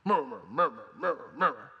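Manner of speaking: grumbling murmur under the breath; mock murmuring. Preacher acts out a worker grumbling, muttered murmur.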